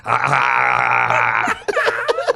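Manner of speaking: slow laughing ah ah ah; mock moaning. To friend, mocking his moans in the bit. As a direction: ham up drawn-out moans. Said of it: "You're like".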